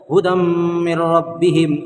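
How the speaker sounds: हुदम मिर रब्बिहिम chanted with a nasal hum merging the m sounds; tajweed nasalization demo; with ghunna. Shown as a demonstration. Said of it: "गुन्ना करके पढ़ना है".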